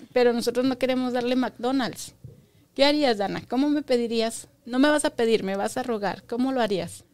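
ordinary delivery

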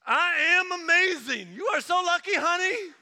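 strut like a man who loves his reflection; cocky guy at the mirror. Retold or imitated. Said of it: "and he's just like".

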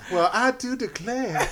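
high, slow hammed-up Southern belle; Southern belle accent. Answering a friend's tease, exaggerated Southern belle accent. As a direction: drawl it like a mock belle of the South. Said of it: "Says the Belle of the South".

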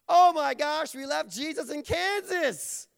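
loud, high panicked outburst; comic shout of sudden realization; panicked parents. Acted. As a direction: blurt it out in mock alarm. Parents realize child left behind.

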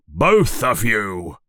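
slow, drawn-out order in character; mock yell. A narrator's reading. Uncle Vernon sends both boys out, mock yell. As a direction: stretch the order out sternly. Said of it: "yells Uncle Vernon".

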